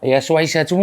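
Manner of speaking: usual speaking voice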